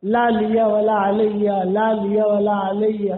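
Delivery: low, slow, drawn-out murmured plea; dying caliph's repeated plea. Retold or imitated. Preacher voicing Umar's dying repeated words, low and slow. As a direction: sink the voice and stretch each word like a dying man. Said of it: "ایک ہی بات کہہ رہے تھے".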